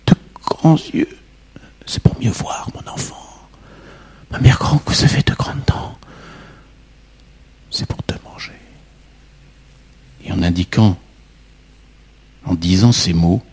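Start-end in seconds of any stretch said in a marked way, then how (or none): 0.1-8.5 whisper. A narrator's reading. Whispered build-up to the bite.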